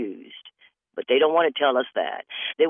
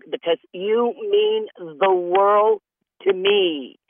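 mock lover; 8 semitones higher, 3 dB louder, slower than usual. Mock scammer rising.

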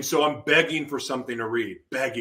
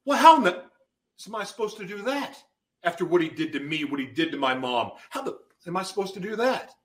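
incredulous protest; 6 semitones higher than usual. Retold or imitated. Arguing back to God's command.

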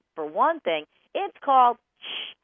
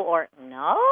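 "no" with a rising, questioning tune; different-sounding no; 8 semitones lower than usual. Shown as a demonstration. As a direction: lift "no" up like a question. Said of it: "it doesn't sound the same".

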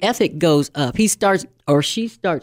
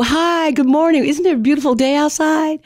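mock chirpy greeting, higher and brighter in pitch; bubbly parrot; 10 semitones higher than usual. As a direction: gush like an overexcited chatterbox coworker. Chatty parrot voiced bubbly.

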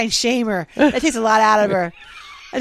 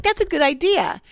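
mock eager approval; 8 semitones higher than usual. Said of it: "And I'm like".

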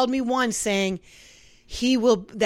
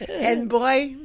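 usual speaking voice

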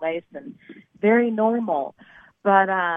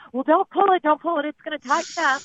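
high urgent warning; 7 semitones higher, 5 dB louder than usual. Retold or imitated. Guy warns her, retold quote.

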